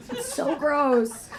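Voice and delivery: usual speaking voice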